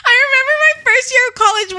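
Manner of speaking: very high, squeaky voice holding back laughter; laugh-choked. Real, not acted. Restarting a college story for co-host, laugh-choked. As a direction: push the story out while cracking up.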